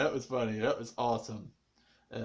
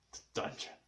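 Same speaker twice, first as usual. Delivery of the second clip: whispered insistence; 12 dB quieter than usual. Shown as a demonstration. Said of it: "I told you it was a dungeon".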